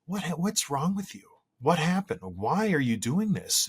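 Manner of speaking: half-joking baffled parent voice; exasperated parent. Retold or imitated. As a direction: ask your kid what on earth is wrong with them. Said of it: "look at your kids and go".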